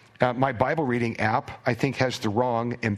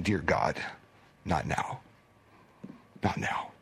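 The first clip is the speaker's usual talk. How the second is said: softer, lower, pleading under the breath; a hushed plea at a bedside; desperate hushed prayer; 7 semitones lower, 6 dB quieter than usual. Retold or imitated. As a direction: beg God quietly in a crisis. Caregiver praying during a seizure.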